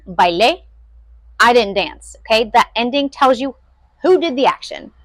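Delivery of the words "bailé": "bailé" with the last syllable stressed; stress on final syllable. Shown as a demonstration. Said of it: "E with the accent on the end".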